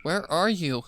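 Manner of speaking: a monster faking a mother's call; lightly voiced eerie lure; eerie mimic voice. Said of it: "as if another was whispering loudly".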